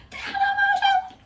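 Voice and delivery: high, loud fangirl scream of the name; squealing fan losing her mind; excited scream. Retold or imitated. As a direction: shriek the name like you just spotted her.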